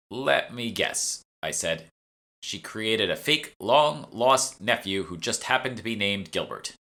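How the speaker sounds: slow, drawn-out sarcastic guess; slow wry guess at the mother's scheme; slow knowing guess. Acted. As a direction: spell it out knowingly. Narrator guesses mockingly.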